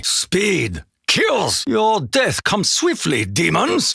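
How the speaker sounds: high-pitched, savage taunt; fierce battle taunt. Acted. Takeo taunts the demons as he attacks, fierce high taunt. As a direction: spit the promise of death at them.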